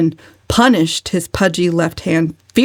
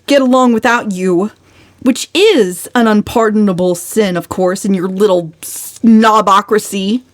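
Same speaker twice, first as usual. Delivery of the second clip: loud, high, outspoken tirade; brazen tirade; 9 semitones higher, 4 dB louder than usual. A narrator's reading. Valancy scolding her gossiping relatives, brazen and loud. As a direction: let the family have it at last. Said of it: "said Valancy brazenly".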